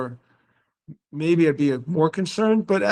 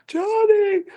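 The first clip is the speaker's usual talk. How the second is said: very high, amazed cry of the name; starstruck squeal. Retold or imitated. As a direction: gasp his name like you can't believe it. Shocked to hear [name].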